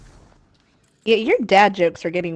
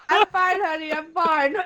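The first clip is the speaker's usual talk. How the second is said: high-pitched words squeezed out through a laugh; trying to reassure while cracking up; 12 semitones higher, 6 dB louder than usual. Real, not acted. Laughing fit, cough after eating.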